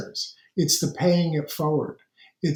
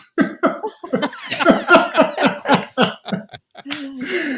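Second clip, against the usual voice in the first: hearty laughter; 11 semitones higher, 4 dB louder than usual. Real, not acted. Panel laughs at the elders group joke, hearty laughter. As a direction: burst of high, long laughter.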